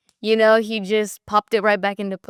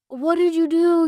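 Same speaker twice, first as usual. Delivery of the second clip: higher, louder startled dad; imitating dad alarmed; 10 semitones higher, 5 dB louder than usual. Daughter imitating her dad's alarm over the broken panel. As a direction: play dad catching the mistake. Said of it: "and dad was just like".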